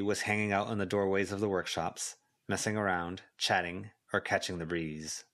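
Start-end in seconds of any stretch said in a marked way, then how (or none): none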